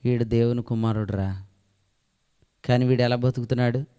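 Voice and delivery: the angels talking low among themselves about him; angels muttering. Retold or imitated. Preacher voicing angels whispering their doubts, low and hushed. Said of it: "దూతలందరూ ఏమనుకుంటారంటే".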